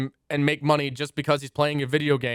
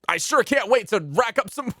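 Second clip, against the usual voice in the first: overacted anime dub; 7 semitones higher than usual. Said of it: "esports anime main character".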